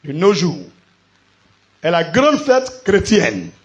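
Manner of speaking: loud, emphatic church preaching; preacher in full voice; forceful preaching. Real, not acted. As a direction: proclaim it to the congregation, drawing out key phrases.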